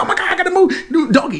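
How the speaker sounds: high, frantic shout; panicked yelp. Retold or imitated. Billy holding the bus, yelling high and frantic.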